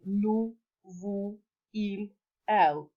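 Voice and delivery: "nous, vous, il, elle" with no S at the end; silent final S. Shown as a demonstration. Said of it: "S is never pronounced at the end".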